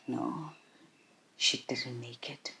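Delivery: hushed, nearly whispered, low; very quiet retold bad news, halting; breaking death news. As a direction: let the words sink almost to a whisper. Retells news of mother's death.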